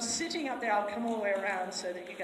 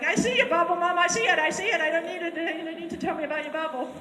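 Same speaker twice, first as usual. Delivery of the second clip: higher, louder horse voice, fed up; annoyed horse; 6 semitones higher, 7 dB louder, faster than usual. Retold or imitated. The horse answering his handler, exasperated and higher. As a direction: groan it like a nagged teenager. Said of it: "he's like".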